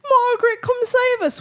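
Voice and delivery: high calling out; mock plea for help. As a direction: call for rescue playfully. Calling for the real host.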